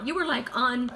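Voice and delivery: usual speaking voice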